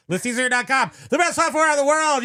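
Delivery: loud, high commercial pitch; hyped ad read. Pretend. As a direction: sell it like a TV announcer. Hyping the software like an ad.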